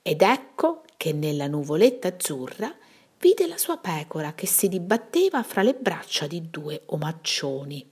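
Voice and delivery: plain reading voice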